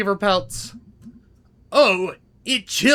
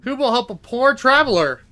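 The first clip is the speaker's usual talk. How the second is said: calling for help; 4 semitones higher than usual. Acted.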